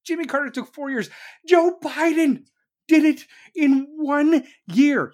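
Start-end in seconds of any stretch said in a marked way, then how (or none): 1.5-5.1 loud, slow, hammering each word; louder rant. Real, not acted. Ranter hammering home that Biden did it in a year, loud and slow. Ranting, punching the contrast.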